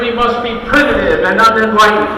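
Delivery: loud, rousing rally speech, swelling to a shout and then easing; rally oratory. Acted. A candidate firing up a rally crowd, loud and rousing. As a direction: pump up the crowd line by line.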